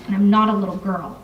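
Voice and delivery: ordinary delivery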